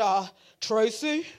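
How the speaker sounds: slow, drawn-out imitation of a visiting minister; imitating the man's talk. Visiting man steps off plane and greets her, slow imitation. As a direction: say it slowly, in his manner. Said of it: "That's how he talked".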